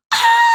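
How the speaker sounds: short, very high, loud shriek; mock panicked scream. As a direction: shriek as if overwhelmed by the call. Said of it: "I'm like".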